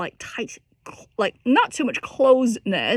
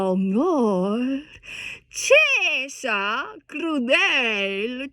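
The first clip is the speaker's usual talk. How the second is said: very slow, drawn-out spoken Italian; slowly spoken lyrics; much slower than usual. Shown as a demonstration. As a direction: speak the lyric slowly, shaping each syllable. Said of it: "I'll just speak it".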